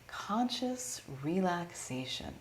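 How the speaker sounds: "Conscious relaxation" drawn out slowly; savored repeat. Real, not acted. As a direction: stretch out "Conscious relaxation", savoring each word. Repeats the term, savouring it.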